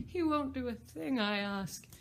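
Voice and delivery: mournful. Acted. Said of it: "in a mournful tone".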